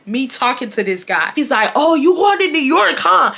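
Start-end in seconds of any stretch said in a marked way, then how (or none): 1.7-3.3 higher, louder mock-curious question; mocking nosy imitation. Ex trying to change the subject, mimicked as nosy. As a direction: imitate him prying, pitched well up.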